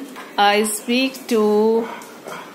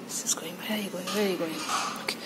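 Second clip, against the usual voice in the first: soft unvoiced whisper; 5 semitones lower, 14 dB quieter, faster than usual. Real, not acted. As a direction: whisper after the pet. Whispering as pet leaves.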